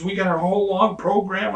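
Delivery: high, pleading plea not to quit; pleading boss. Retold or imitated. Same boss still pleading.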